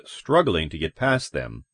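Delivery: ordinary delivery, nothing marked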